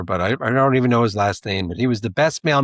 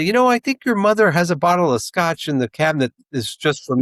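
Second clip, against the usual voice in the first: sly mailman; 6 semitones higher than usual. Retold or imitated. Imitating the mailman Wade.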